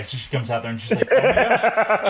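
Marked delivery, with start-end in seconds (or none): 1.1-2.1 high, laughing "oh my gosh" in a wife's voice; a hint of the wife, laughing in surprise; laughing disbelief.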